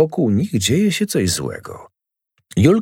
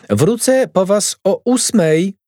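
mother calling after her daughter; calling after them; 10 semitones higher, slower than usual. Acted. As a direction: shout it after them as they walk off. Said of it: "krzyknęła za nimi mama".

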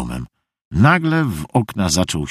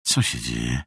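hushed, low, uneasy question; 8 semitones lower, 7 dB quieter than usual. A narrator's reading. Meg sees the dog growling, asks uneasily. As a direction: ask low and softly, with unease. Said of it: "spytała z niepokojem".

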